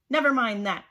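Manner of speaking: loud, sharp snap. Acted. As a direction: cut them off loudly. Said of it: "snapped Miss Swamp".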